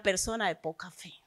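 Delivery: whispered, almost to himself. Real, not acted. Preacher naming the person of little faith, in a whisper. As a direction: let the phrase drop to a whisper. Closes the point in a hushed voice.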